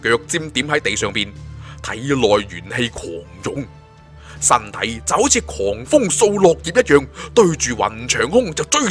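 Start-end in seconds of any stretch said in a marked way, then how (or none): none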